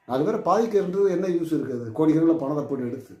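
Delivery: usual speaking voice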